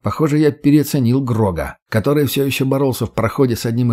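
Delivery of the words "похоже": usual speaking voice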